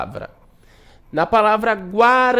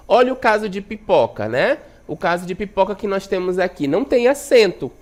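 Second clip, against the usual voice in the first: usual speaking voice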